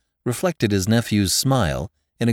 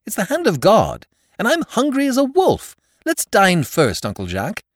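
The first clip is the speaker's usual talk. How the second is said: put-on excited young man; 13 semitones higher, 4 dB louder than usual. Hungry nephew pushes paper aside.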